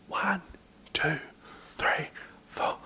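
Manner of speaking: whispered counting. Retold or imitated. Dad doing the breath test, whispered count. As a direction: count under your breath. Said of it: "And I went like".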